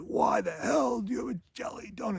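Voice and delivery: high, restrained imitation shout; mock sergeant's yell. Sergeant demands explanation for the donut, mock yell. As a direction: demand it as a put-on furious drill sergeant. Retold sergeant interrogating recruit.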